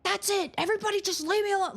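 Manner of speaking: high-pitched, rushed outburst without much volume; mock yelling. Shown as a demonstration. Mom showing what she never does to kids, mock yell. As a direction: act out a parent snapping, fast and high. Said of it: "but I don't go".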